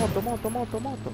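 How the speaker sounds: high urgent warning, moto repeated; excited callout. Real, not acted. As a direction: sound the alarm, pitch up. Enemy motorbike spotted in game.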